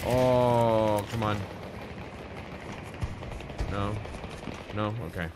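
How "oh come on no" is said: usual speaking voice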